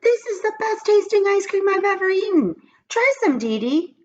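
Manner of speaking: put-on delighted boy's voice; excited boy. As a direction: rave like the best treat ever. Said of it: "he said".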